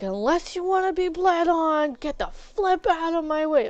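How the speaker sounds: mock-yell to clear a path; retold yell. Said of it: "after I yelled".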